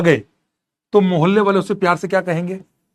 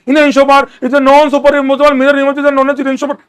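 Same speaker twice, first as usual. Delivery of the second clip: high, loud, slow parroting of a memorized line; mock rote recitation; 7 semitones higher, 5 dB louder, slower than usual. Teacher mocking a student parroting a definition, high and loud. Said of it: "बच्चे रटते हैं".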